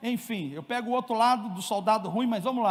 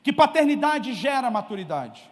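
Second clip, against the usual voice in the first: firm preaching; 2 semitones higher, 3 dB louder than usual. Acted. Preacher drives home fatherhood lesson to congregation, emphatic.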